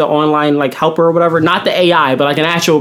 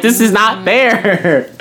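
slow protest; 3 semitones higher, much slower than usual. Retold or imitated. Protesting unfair grading.

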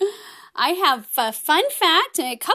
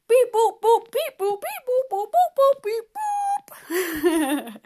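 high-pitched robot beeping and booping; playful robot beeps; 7 semitones higher than usual. Pretend. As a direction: beep like a toy robot on the breath out. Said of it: "go beep, boop, beep every time we breathe out".

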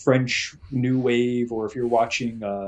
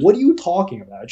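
incredulous; 6 semitones higher, 5 dB louder than usual. Retold or imitated. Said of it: "I'm like".

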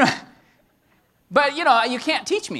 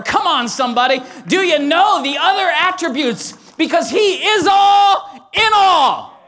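excited preaching shout; 5 semitones higher, 4 dB louder than usual. Real, not acted. Pastor praising Jesus's attributes, rising to a shout. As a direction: build it to a rapturous cry. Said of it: "I get a little excited".

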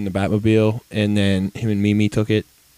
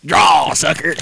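loud, higher cowboy challenge; cowboy shout; 6 semitones higher, 7 dB louder, slower than usual. Retold or imitated. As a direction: call out a duel like a cowboy. Imagined cowboy chasing him.

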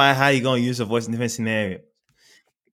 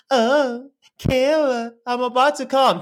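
high falsetto imitation of the dude; mocking the dude; 12 semitones higher, much slower than usual. Mocking the guy from the video in high falsetto. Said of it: "the dude was like".

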